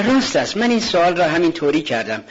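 nervous, laughing excuse. Acted. Covering up with a laugh. (بهانه با خندهٔ عصبی)

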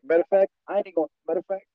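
muffled, like through a mask. Real, not acted. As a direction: sound muffled and dull. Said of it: "You sound so muffled right now".

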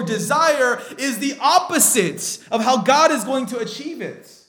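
loud, high-pitched impassioned sermon; full-force preaching. Real, not acted. Preacher tells Christians what they really desire, in loud fervent preaching. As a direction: drive every phrase home to the congregation.

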